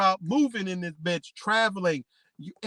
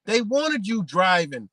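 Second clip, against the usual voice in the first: ordinary talk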